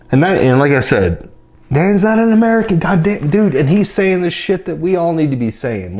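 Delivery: excited. Real, not acted. Excited rant about Dan.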